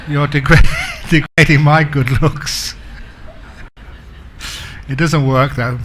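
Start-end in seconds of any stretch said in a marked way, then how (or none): 0.1-2.7 louder, laughter breaking into the words; laughing. Real, not acted. Speaker joking to the audience about getting old, laughing. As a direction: chuckle through your own punchline. Chuckles at his own joke.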